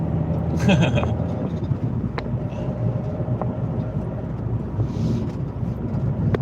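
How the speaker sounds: long airless giggling fit; can't stop laughing; wheezing breathless laughter. Real, not acted. Laughing after punchline.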